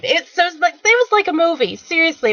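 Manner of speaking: excited disbelief, like a movie; amazed. Real, not acted. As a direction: gush about how unreal it was. Amazed recap of the rush.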